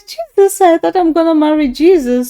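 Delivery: high, loud, amused exclamation; giddy delight. Retold or imitated. Woman recalls her groom looking like Jesus, giddy delight. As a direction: burst out loudly in a high, delighted voice.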